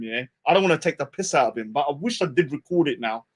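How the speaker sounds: usual speaking voice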